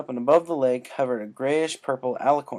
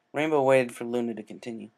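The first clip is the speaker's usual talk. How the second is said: ordinary delivery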